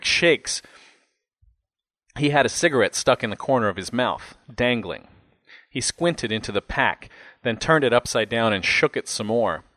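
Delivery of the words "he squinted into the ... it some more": ordinary delivery, nothing marked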